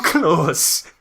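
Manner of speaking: normal talking voice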